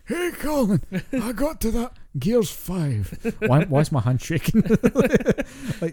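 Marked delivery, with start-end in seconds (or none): 0.1-4.6 put-on much higher, slow, frail old-man voice; mock ancient man telling his friend he finished Gears five; old man on deathbed. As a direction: play a shaky old man finally done with the game.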